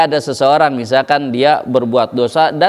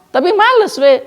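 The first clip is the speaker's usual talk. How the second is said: mock lazy excuse; 14 semitones higher than usual.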